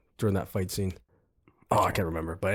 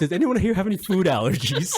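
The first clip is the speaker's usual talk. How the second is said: higher, polite question through amusement; suddenly normal voice; 10 semitones higher than usual. Retold or imitated. Agent checking the players for food allergies, normal lighthearted voice. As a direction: ask it lightly, like a courteous host. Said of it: "He goes normal".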